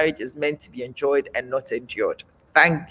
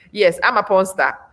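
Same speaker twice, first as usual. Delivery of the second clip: ordinary talk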